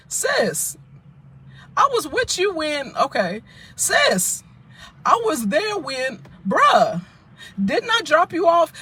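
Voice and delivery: indignant high callout. Retold or imitated. Confronting the gossiping friend.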